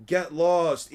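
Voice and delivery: loud, curt shout to drive him off; shouted get lost. Acted. Villagers yelling at the quack doctor to leave, loud shout. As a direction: bark it at the intruder.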